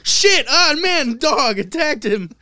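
loud, slow, high panicked cry; mock panicked yell. Boy being attacked by the dog, loud slow panicked yell. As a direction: yell like a dog just bit you.

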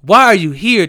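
loud demand in disbelief; exasperated shout. Real, not acted. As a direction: shout the question at her. Exasperated repeat at the singer.

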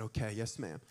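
put-on soft, low, chastened child; small, obedient mumble; meek reply. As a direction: answer mom quietly and submissively. Child answers mom's stern talk.

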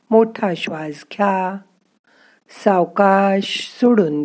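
slow, soft relaxation voice; guided meditation. Acted. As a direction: calmly guide the breathing, slowly. Leads breathing and relaxation exercise.

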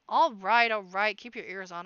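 gruff guard calling through a door, loud; grumpy muffled guard. A narrator's reading. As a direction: shout it through the heavy door.

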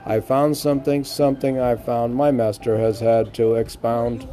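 lifted, measured poem reading; poem recital. Acted. Reciting his poem on the podcast, measured verse voice.

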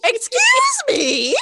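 high, slow, shouted indignation; mock shocked outrage; indignant excuse me. Mock offense at being called crazy.

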